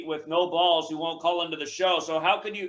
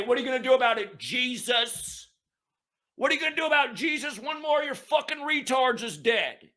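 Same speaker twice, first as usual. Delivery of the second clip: mock taunt; 6 semitones higher than usual. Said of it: "and I'd say".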